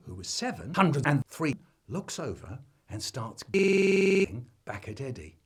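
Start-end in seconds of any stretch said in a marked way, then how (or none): none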